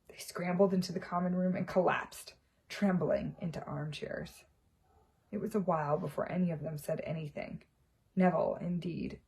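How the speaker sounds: plain reading voice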